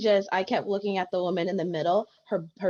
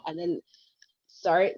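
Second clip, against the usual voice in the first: ordinary delivery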